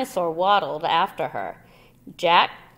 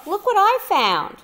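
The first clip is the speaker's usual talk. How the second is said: excited call; 13 semitones higher, 6 dB louder than usual. A narrator's reading. Jack calling to Annie.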